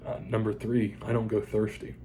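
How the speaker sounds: ordinary talk, nothing marked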